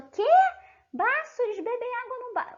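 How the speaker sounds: high, puzzled student asking back; confused student. Retold or imitated. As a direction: sound baffled like a confused student. Student confused by word baço. (aluno confuso, voz aguda de espanto)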